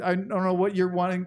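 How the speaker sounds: ordinary delivery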